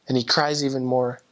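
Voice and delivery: ordinary talk, nothing marked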